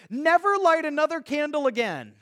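hammed-up order from a friend who hates candles; mock stern command. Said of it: "and he would say".

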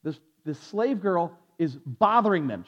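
ordinary talk, nothing marked

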